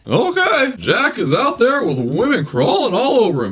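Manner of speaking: high, jittery nervous comic voice; Don Knotts impression. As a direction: play it twitchy and flustered. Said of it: "play Don Knotts".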